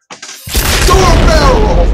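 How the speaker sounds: high, loud, drawn-out yell; yelled repeat. Real, not acted. Gamer narrating a failed jump, drawn-out yell. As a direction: bellow it, stretching every word.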